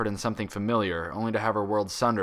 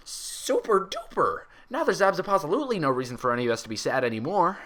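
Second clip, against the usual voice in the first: cheerful; 8 semitones higher than usual. Acted. Pinkie cheering up her friends, high and bouncy. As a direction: bounce through it. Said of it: "declared cheerfully".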